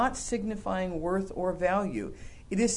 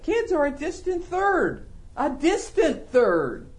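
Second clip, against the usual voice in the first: slow, higher stress on distant third; emphatic, slowing down; 5 semitones higher, 3 dB louder, slower than usual. Real, not acted. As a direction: drag out distant third to drive it home. Preacher pressing a point.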